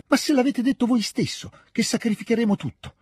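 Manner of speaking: put-on very high, insistent boyish retort; indignant protest. A narrator's reading. Petia throws his father's words back, high protest.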